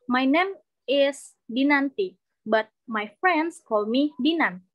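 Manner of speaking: slow, clear model introduction; slow demo sentence. Shown as a demonstration. Teacher slowly models an introduction for students. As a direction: spell it out slowly for the class. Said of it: "For example".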